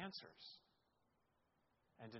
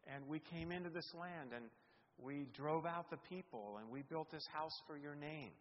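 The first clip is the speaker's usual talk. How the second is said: voiced prayer; 2 semitones higher, 6 dB louder than usual. Preacher voicing king's prayer.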